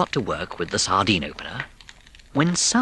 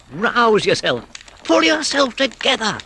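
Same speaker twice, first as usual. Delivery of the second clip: higher brisk urging voice; Rat rallying; 12 semitones higher, 3 dB louder than usual. A narrator's reading. Rat to downcast Mole, briskly rallying. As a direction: shake him out of his gloom. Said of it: "said Rat".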